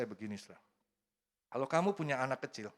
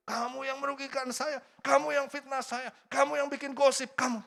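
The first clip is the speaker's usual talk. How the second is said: high, loud accusing jabs; mock accusation; 12 semitones higher, 5 dB louder than usual. Accusing the person who slandered you, high and loud. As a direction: point the finger at each charge.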